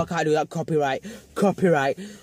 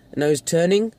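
ordinary talk

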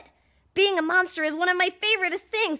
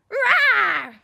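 loud, full-throated mock roar; monster roar; 7 dB louder than usual. Shown as a demonstration. Host leading kids in a roar, loud monster roar. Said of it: "Roar the way I do".

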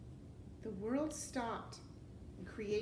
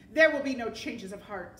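loud, forceful villain refusal; firm refusal; 12 dB louder than usual. A narrator's reading. Rat refusing to lead them out, loud and final. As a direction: shut it down at full volume.

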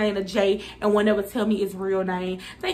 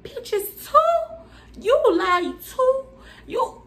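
squeaky high laughing-crying shock; shocked disbelief; 12 semitones higher, much slower than usual. Real, not acted. To the girl who lied, a high laughing shriek.

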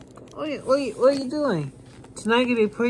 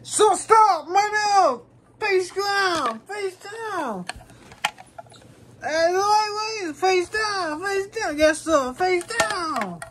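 high, urgent shouted orders; shouted police command; 8 semitones higher, much slower than usual. Pretend. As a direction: yell it high like an officer making an arrest. Officer shouting commands at suspect.

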